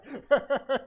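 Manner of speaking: loud high cackling laugh; real amused laughter; high burst of laughter. Real, not acted. Laughs at own anecdote.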